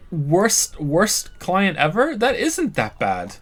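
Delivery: high, slow disbelief; surprised. Acted. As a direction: protest in disbelief. Disbelieving protest.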